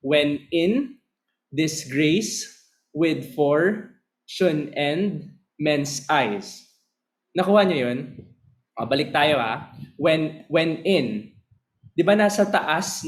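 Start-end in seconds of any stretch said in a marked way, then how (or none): 0.1-6.6 slow, rising stress on each beat; stretched out iambic recitation; iambic reading. Shown as a demonstration. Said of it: "So, bal-, bale pataas tayo". 10.0-11.4 slow da-DUM on "when in"; stress on in. Shown as a demonstration. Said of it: "Nasa in yung stress".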